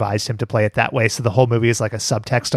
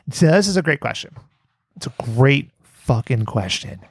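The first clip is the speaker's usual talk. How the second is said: usual speaking voice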